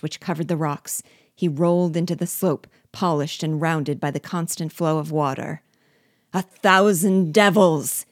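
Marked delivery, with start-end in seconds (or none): 6.3-8.0 loud slow roar. Acted. Falling dwarf roars a curse, loud and slow. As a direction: bellow the curse as he falls. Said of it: "he roared".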